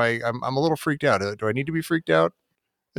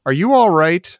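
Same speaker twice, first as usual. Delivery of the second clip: quoting; 3 semitones higher, 7 dB louder than usual. Retold or imitated. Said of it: "She goes".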